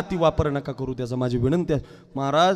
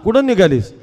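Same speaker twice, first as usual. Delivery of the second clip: loud, raised call of a name; 6 semitones higher, 11 dB louder, slower than usual. Acted. Household calling Jana back from the door, loud call. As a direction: shout for her across the house. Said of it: "तेवढ्यात आवाज आला".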